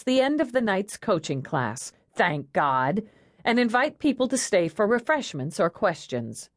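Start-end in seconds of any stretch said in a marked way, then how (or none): none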